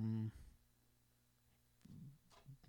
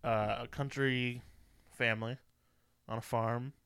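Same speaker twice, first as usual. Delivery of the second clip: ordinary talk, nothing marked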